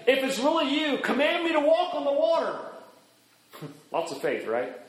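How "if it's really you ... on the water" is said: high-pitched call across distance. Acted. Peter on the boat to Jesus on the water, calling out. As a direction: call out, climbing higher. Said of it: "he calls out to Jesus and he says".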